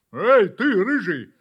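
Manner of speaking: loud dog's call across the yard. Acted. Dog calls the red kitten across the yard, loud. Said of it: "позвала собака".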